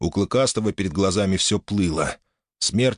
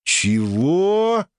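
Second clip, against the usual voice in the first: surprised; 10 semitones higher, 3 dB louder than usual. Acted. Healer surprised.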